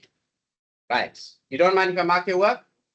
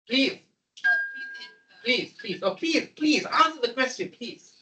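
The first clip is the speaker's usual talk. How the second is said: mock pleading; 5 semitones higher than usual. Begging student to answer.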